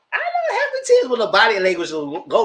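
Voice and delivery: laughing skeptical; laughing doubt. Real, not acted. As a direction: laugh at the idea while saying it.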